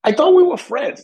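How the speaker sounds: high, wounded little voice; mock hurt. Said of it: "I'm like".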